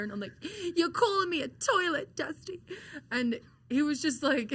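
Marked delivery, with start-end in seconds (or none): 0.8-2.6 mock indignation. Upset trainee answers her mentor, re-enacted high indignant protest. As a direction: re-enact an affronted, high-pitched retort.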